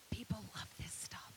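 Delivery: whispered aside to the audience; conspiratorial whispered aside. Real, not acted. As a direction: lean in and whisper it.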